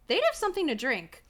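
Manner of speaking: usual speaking voice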